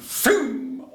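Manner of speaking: very high, loud whoosh of spurting blood; a burst of sound like blood spraying; blood spray noise. Retold or imitated. Telling how the blood sprayed, a high loud whoosh.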